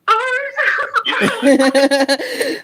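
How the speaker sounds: long rolling laugh at the clip; big laugh. Real, not acted.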